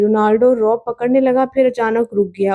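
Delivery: usual speaking voice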